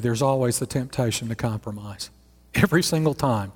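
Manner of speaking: ordinary talk, nothing marked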